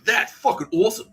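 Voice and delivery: high, loud mock enthusiasm; mock gushing. Mimicking the boss stealing credit, mock excited. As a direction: gush like a fake-thrilled boss.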